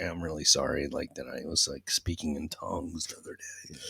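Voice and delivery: low, hushed apology; apologetic. Retold or imitated. Going round the neighbors to apologize, low quiet contrition. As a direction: drop right down, soft and contrite. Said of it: "go around to a couple people like".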